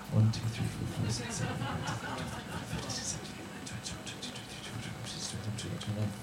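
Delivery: very soft, half-whispered head count; tally the hands quietly to yourself; barely audible running count; counting under breath. Real, not acted.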